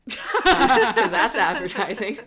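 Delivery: laughing through a joke; giggling the punchline out. Real, not acted. Host joking to listeners about a smoothie spoiler, laughing.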